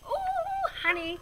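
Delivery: high, crooning ooh; delighted coo. Acted. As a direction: swoon over honey. Edgar delighted by honey.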